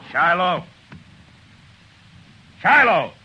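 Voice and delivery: loud, high call across the street. Acted. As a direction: shout for Shiloh to come out. Calling out for jailer.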